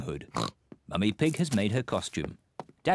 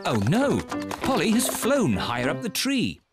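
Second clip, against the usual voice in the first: alarmed narration; 6 semitones higher, 4 dB louder than usual. A narrator's reading. Narrator reports parrot escaping higher.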